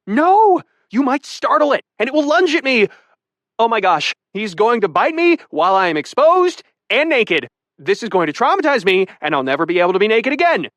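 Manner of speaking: high, rushed, fearful woman's voice; terrified woman in the shower pleading; frantic panic. A narrator's reading. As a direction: beg him not to startle it. Terrified woman warns about snake.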